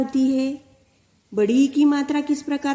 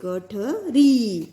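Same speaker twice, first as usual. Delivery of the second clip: गठरी drawn out, ई held long; slow teacher demo; slow clear reading; much slower than usual. Shown as a demonstration. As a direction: stretch the final ई in गठरी. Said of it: "बड़ी ई है".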